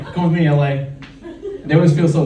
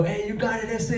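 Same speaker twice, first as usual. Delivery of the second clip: mock Mexican friend; 7 semitones higher, 8 dB quieter than usual. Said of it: "I heard him go".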